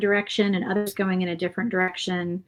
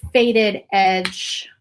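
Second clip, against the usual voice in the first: slow drawn out; 6 dB louder than usual. Real, not acted. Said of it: "drawn-out contrasting phrase".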